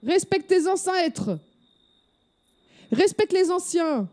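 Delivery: put-on high, scolding old lady; outraged granny telling off a rude delivery boy; indignant granny. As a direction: tell him off like an offended grandma.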